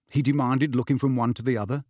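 plain reading voice

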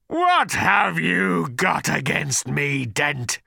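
slow menacing creature snarl. Acted. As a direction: growl it out slowly, like a cornered beast. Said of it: "snarled the creature".

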